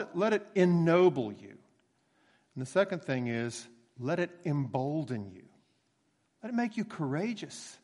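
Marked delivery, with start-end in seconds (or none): none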